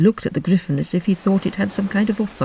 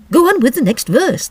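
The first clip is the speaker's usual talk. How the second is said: higher and louder, impatient repeat; 8 semitones higher, 5 dB louder than usual. Acted. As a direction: push her on, fed up. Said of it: "repeated impatiently".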